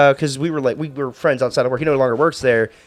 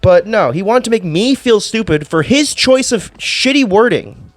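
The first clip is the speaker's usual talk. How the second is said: higher, louder, slow exasperated ranting; indignant rant; 7 semitones higher, 3 dB louder than usual. Real, not acted. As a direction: vent at the camera, fed up. Ranting about the boss.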